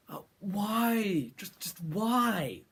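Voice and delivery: slow, disbelieving why; exasperated. Real, not acted. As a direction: sigh it out in disbelief. Exasperated at the film.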